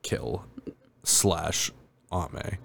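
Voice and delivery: very low, soft, word by word; deadpan, pausing after each word as if typing; typed command. Acted. Typing a kill command into a game console, quiet deadpan.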